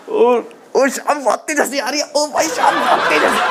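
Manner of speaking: hammed-up laughing friend; mock laughing message. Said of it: "कहते हैं". (नकली हंसते हुए दोस्त की आवाज़)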